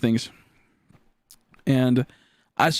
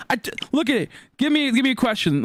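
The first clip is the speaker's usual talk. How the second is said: frustrated challenge; 9 semitones higher than usual. Retold or imitated. Retold argument with math teachers.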